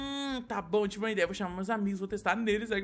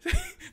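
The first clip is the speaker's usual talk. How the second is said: short high-pitched giggle; quick high giggle. Acted. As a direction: squeak out a laugh. Laughs at olive joke.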